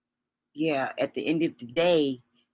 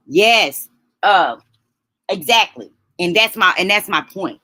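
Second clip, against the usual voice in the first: much louder, emphatic agreement; 2 semitones higher, 9 dB louder than usual. Real, not acted. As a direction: hit it hard, firmly agreeing.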